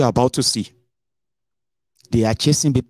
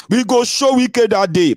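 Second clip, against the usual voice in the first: high, loud taunt; defiant high shout; 13 semitones higher, 5 dB louder, slower than usual. Retold or imitated. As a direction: fling it out like a defiant crowd. Quoting angry voters.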